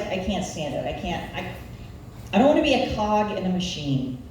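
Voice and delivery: normal talking voice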